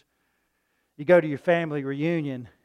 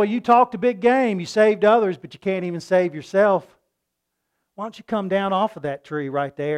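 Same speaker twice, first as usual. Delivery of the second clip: jeering priests; 5 semitones higher, 11 dB louder than usual. Retold or imitated. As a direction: taunt him to come down off the tree. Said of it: "they looked at him and they said".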